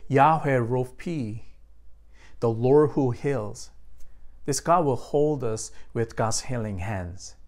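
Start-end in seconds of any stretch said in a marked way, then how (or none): none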